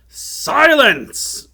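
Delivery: high, booming shout for silence; commanding shout. A narrator's reading. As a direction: shout the whole room down. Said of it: "shouted the enchanter".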